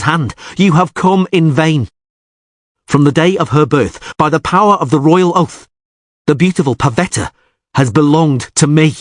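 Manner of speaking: lightly voiced haughty suitor claiming his bride; proud suitor's proclamation. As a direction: announce the claim to the whole hall.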